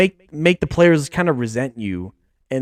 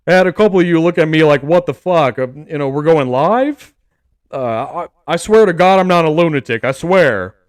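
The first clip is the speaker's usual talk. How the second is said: a rough, louder coach impression, lightly done; bad Dan Campbell voice; 4 dB louder than usual. Coach Dan Campbell reassuring his players at practice, mock gruff voice. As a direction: growl it out like a fired-up head coach. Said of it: "I'll try to do it in the Dan Campbell voice too".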